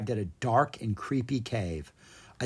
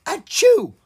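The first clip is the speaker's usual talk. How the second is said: loud sneeze; 8 dB louder than usual. Acted. Dragon sneezes again.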